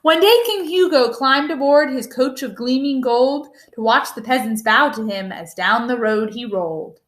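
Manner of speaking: ordinary delivery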